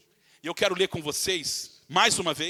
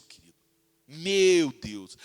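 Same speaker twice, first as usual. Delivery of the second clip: ordinary talk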